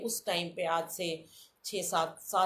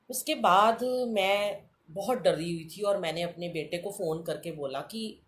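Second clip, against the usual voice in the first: ordinary talk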